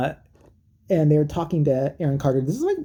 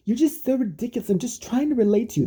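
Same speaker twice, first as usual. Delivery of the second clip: higher-pitched mock girl voice; imitating young woman; 8 semitones higher than usual. Girl trying to relate to him, imitated higher. Voicing girl in retold argument.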